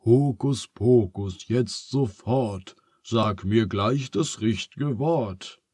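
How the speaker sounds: slow chanted spell; incantation. A narrator's reading. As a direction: intone the rhyme deliberately. (langsam aufgesagter Zauberspruch)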